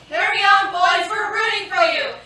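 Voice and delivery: loud high rallying cheer; cheering shout. Acted. Girls cheering the soldiers on with a loud shout. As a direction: yell it like a cheerleader.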